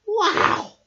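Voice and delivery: high, loud amazed wow; be awed by the books; amazed gasp. Acted. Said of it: "gasped Rabbit".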